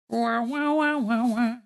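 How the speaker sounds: muffled trombone-like wah wahs; muffled wah wah talk. Retold or imitated. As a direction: mimic muffled adult speech from cartoons. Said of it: "Exactly like that, huh?".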